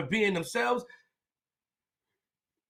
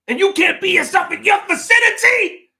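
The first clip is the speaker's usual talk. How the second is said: shouted high and loud at the chat; angry shout; 11 semitones higher, 9 dB louder than usual. Real, not acted. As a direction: yell it out, fed up.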